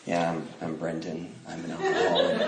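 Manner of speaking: low, hushed, serene self-introduction; put-on spiritual. Young man introducing himself at a meeting, mock spiritual calm. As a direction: speak softly as if newly enlightened. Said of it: "supposed to talk like this is spiritual".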